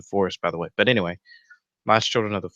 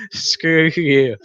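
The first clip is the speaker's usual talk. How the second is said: much higher and louder, cracking up mid-line; laughing protest; 7 semitones higher, 5 dB louder than usual. Real, not acted. Telling roommate Joe to shut up while laughing. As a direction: laugh through a playful shut up.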